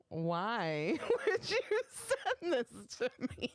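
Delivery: slow, laughing incredulous question; laughing disbelief. Retold or imitated. Retold disbelief.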